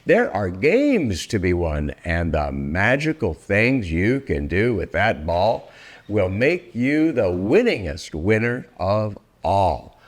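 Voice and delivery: ordinary delivery, nothing marked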